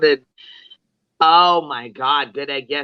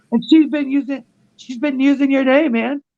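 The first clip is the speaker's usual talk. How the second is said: high squeaky mock of an angry streamer; 11 semitones higher than usual. Mocking the streamer to a friend, high silly voice. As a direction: jeer at him in a high silly voice. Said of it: "He's like".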